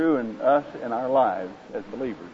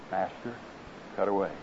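much softer and lower, hushed; low whisper; 5 semitones lower, 9 dB quieter than usual. Acted. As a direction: murmur consent, trembling in expectancy. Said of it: "whispering low".

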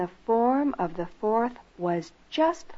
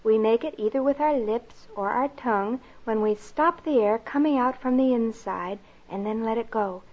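plain reading voice

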